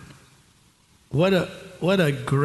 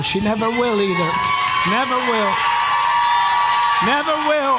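repeated cry climbing much higher with each round; building triumphant shout; 7 semitones higher, 4 dB louder than usual. Real, not acted. Preacher declaring her hearing healed to the congregation, rising exultant shout. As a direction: declare it harder and higher every time.

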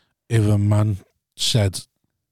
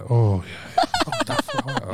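sexy voice; 4 dB quieter, much slower than usual. Pretend. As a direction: coo it out, mock-seductive. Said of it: "what do women say".

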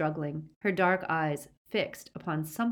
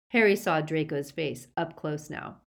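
plain reading voice

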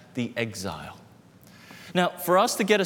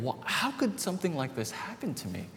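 put-on a touch of higher, softer childish dismay; dismayed wondering; 6 semitones higher, 5 dB quieter than usual. Boy staring at his empty chip bag, mildly dismayed. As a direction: ask it like a wronged little kid. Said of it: "I'm wondering".

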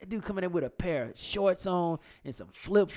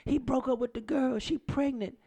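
put-on quoting the girl; 10 semitones higher than usual. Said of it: "one of the girls come and say".

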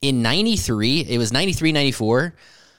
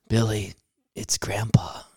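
acted soft, near-whispered bad news; hushed sad news; 3 semitones lower, 6 dB quieter than usual. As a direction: drop to a hush, imitating a grieving mom. Mom breaking sad news.

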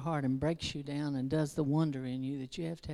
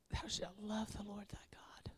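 breathy hushed whisper, much softer; 6 semitones higher, 10 dB quieter than usual. Shown as a demonstration. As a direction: breathe "Thou shalt love the Lord thy God" like a secret. Whispered repeat of the commandment.

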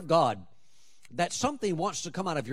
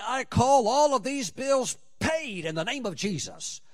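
raised, ringing bold declaration; mock declaration; 7 semitones higher than usual. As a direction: proclaim it like a believer claiming a promise. Voicing believer's declaration.

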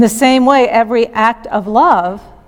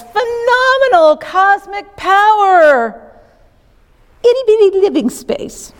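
Robin Williams genie; 10 semitones higher than usual. Retold or imitated. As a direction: belt it out like a showman genie. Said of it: "gives voice to this big blue genie".

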